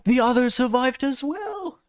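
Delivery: a squeal of stunned disbelief; astonished disbelief. Real, not acted. Teammates survive unexpectedly.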